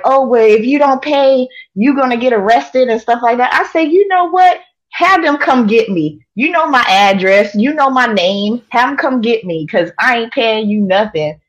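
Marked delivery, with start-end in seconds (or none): 0.0-2.9 mimicking the caller's pushy warning, higher and louder; scammer's threat. Retelling the phone scammer, a higher, louder mock threat. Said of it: "And they was like". 3.9-11.4 telling the caller off, fired up; defiant retort. Retold or imitated. Retelling his answer to the scam caller, heated and defiant.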